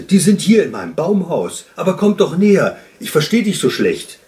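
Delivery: hailing them from a distance; calling out. A narrator's reading. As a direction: shout to coax them closer. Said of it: "rief der Wunschhase".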